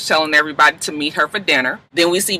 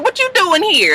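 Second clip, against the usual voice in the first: mock Nate; 12 semitones higher than usual. Nate greeting Bonnie.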